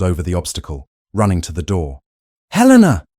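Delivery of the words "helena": very high, loud shout; shouting her name. A narrator's reading. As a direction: yell into the dark corridor. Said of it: "he shouted into the gloom".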